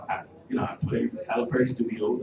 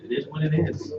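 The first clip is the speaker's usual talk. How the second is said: hopeful inner voice; 5 semitones higher, 5 dB louder than usual. Retold or imitated. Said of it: "so I was like".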